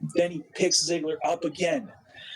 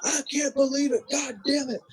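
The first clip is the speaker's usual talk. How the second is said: much higher, anguished commentator wail; Jim Ross impression; 10 semitones higher than usual. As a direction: ham up a distraught announcer pleading. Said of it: "He's like".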